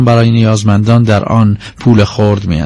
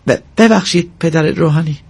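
higher-pitched, stammered apology; moaning plea; 5 semitones higher than usual. Acted. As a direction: stumble on the first sound, pleading meekly. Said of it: "از این رو نالید".

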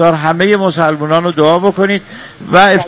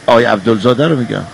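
lower, playful deep voice; 8 semitones lower, faster than usual. Acted. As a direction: talk in a put-on deep bass. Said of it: "باید با صدای بم".

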